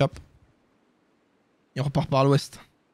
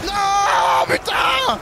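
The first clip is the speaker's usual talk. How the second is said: frustrated shout; much slower than usual. Real, not acted. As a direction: cry out in frustration. Losing the fight, cries out.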